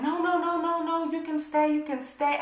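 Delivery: two roommates imitated, pleading. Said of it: "they're both like".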